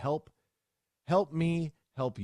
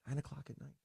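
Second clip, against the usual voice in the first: muttered disbelief; 5 semitones higher, 11 dB quieter than usual. Real, not acted. Repeating the late message time.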